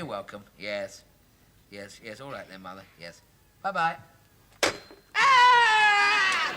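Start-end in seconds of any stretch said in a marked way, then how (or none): 5.1-6.5 high yell of release; shout. Acted. Son hangs up on mother and lets out a yell. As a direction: let out a loud high yell.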